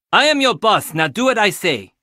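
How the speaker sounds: imitating a bossy older sister barking orders. Teasing guest about bossy sister, mock-commanding. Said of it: "her special way of saying".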